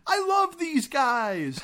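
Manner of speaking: high, slow mock yell; retold yell. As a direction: yell it out gleefully, hammed-up. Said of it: "Machete's yelling".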